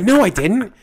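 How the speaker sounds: high, loud, indignant denial; imitated indignant yelling; exasperated doctor. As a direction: argue back like a fed-up doctor. Doctor retold arguing back.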